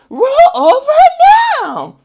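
very high, loud command; shrill command. Retold or imitated. Owner yelling at the puppy, very high loud command. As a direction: shriek the order at the dog. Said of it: "He said".

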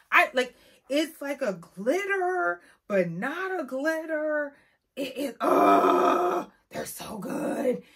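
slow, high, breathless gushing over the nails. Real, not acted. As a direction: swoon over how pretty they are.

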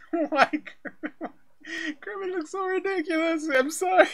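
talking through laughter; giggling while speaking; laughing. Real, not acted. Reacting to a ridiculous clip.

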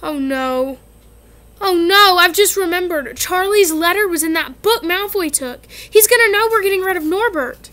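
put-on alarmed boy realizing the danger; panicked. Ron panicking in hospital bed.

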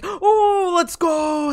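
high, loud whoop of triumph; victory cheer. Real, not acted. Gamer celebrating a won match, victory cheer. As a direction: cheer the win at a high pitch.

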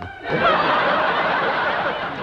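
squeaky high-pitched giggle; a giddy, tittering laugh. Acted. Archdeacon tittering at his own television story.

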